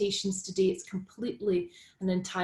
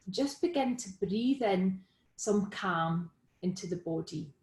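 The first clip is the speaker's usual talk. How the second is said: guided meditation. Real, not acted. Guiding listeners into Be With The Breath, calm meditation delivery. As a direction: lead them gently through it, leaving room to breathe.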